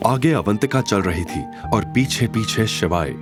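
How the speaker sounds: ordinary delivery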